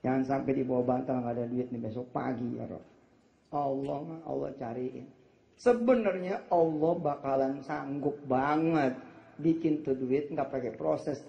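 usual speaking voice

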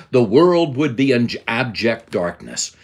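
ordinary delivery

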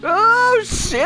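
yelling in shocked delight; excited yelling. Real, not acted. Survives a huge fall, yelling.

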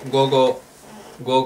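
ordinary delivery

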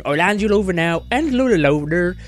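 daft rustic host with a lilting voice; Country Bumpkin. Acted. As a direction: play a broad country yokel. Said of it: "it will be Country Bumpkin".

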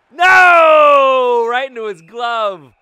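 loud high dismayed yell; anguished sports yell; frustrated shout. Real, not acted. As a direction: cry out as the save happens. Shot saved.

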